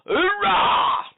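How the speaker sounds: mock Marine cheer. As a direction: bark it like a parody drill chant.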